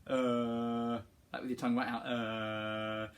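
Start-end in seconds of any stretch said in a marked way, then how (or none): 0.1-1.0 low tongue-out grunt; silly face grunt. Shown as a demonstration. Teacher pulling a face for kids, low grunt. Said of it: "Can you make this face?". 2.0-3.1 low tongue-out grunt; silly face grunt. Shown as a demonstration. Teacher pulling a face for kids, low grunt. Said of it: "can you make this face?".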